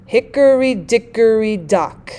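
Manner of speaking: slow DUM-da-da beat, stressing the first syllable of "Hickory" and "dickory", then "dock"; dactylic stress. Shown as a demonstration. Teacher demonstrating the dactyl with an example, dactylic stress. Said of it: "the one stressed followed by two unstressed".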